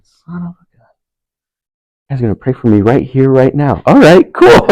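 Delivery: exasperated surprise. Retold or imitated. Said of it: "I said".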